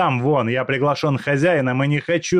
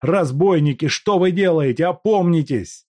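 outraged cry; 9 semitones higher, 7 dB louder than usual. Acted. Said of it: "кричал Степанов".

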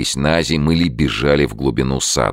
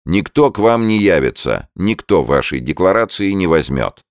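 higher-pitched, emphatic officer's refusal; firm and final, pitched up; categorical refusal; 6 semitones higher, 3 dB louder than usual. A narrator's reading. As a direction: refuse them outright, voice raised a little. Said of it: "Ответ офицера был категоричен".

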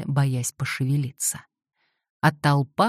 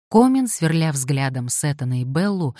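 plain reading voice

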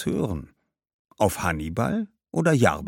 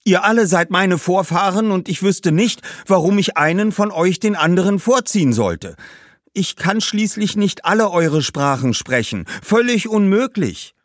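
put-on high, loud boy's protest; calling out exasperated; 8 semitones higher, 3 dB louder than usual. A narrator's reading. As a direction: shout over the crowd of ghosts. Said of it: "Schließlich rief er". (hoch und laut rufender, genervter Junge)

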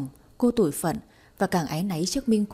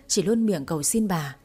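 ordinary delivery, nothing marked

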